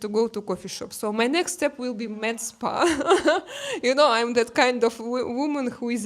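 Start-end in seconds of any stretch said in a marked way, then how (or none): 2.9-3.8 laugh. Real, not acted. Woman joking about copying his med spa visit, laughing. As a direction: burst of higher-pitched laughter.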